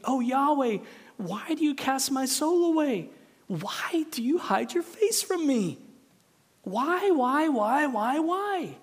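high, insistent cry to God; plead, building to a run of whys; anguished psalm lament; pleading protest. Acted. Preacher voices psalm's protest.

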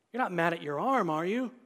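ordinary talk, nothing marked

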